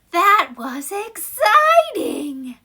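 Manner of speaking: high, very slow laughing shout; thrilled shout. Shown as a demonstration. Teacher acting out thrill after the roller coaster, laughing shout. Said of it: "Exclamation mark".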